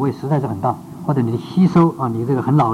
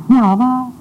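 much higher and louder, eager greeting; bright, excited mood; 9 semitones higher, 5 dB louder, slower than usual. Shown as a demonstration. Pastor showing the class another mood, higher and louder. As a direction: ask it with lively delight. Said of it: "这个mood啊，mood".

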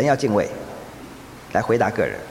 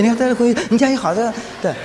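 ordinary talk, nothing marked

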